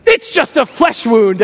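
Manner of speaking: a hint of a stubborn knight; lightly voiced knight brushing off a wound. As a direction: shrug it off bravely.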